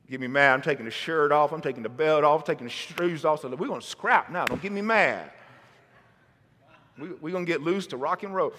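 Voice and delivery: hammed-up dad ready to scrap; joking parent threat. Preacher playing a dad threatening his son Cole, hammed-up. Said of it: "I'm just kidding".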